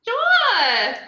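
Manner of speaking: very high, loud stretched squeal; drunk squeal. Acted. Drunk Harley squealing yes to the creeper's offer.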